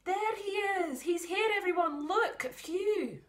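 put-on a kid shouting with joy that he's found; excited child. Child spots missing narrator.

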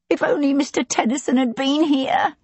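a hint of crying out in distress; tearful cry. A narrator's reading. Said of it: "she cried".

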